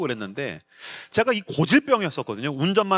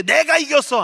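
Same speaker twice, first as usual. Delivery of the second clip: triumphant crow; 10 semitones higher, 5 dB louder than usual. Retold or imitated. Winner crowing after a fight.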